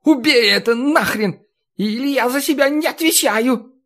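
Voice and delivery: high, cracking, hoarse shout; hoarse falsetto yell. A narrator's reading. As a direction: scream it, voice cracking up. Necromancer shouting from fountain.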